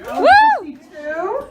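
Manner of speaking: ordinary talk, nothing marked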